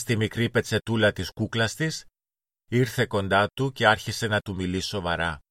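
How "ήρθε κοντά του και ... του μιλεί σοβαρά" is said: ordinary delivery, nothing marked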